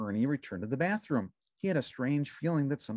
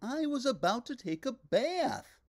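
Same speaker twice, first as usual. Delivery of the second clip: high, bright puppet voice; cheerful little puppet; Ernie voice; 12 semitones higher than usual. A narrator's reading. Narrator voicing Ernie answering the phone, high puppet voice.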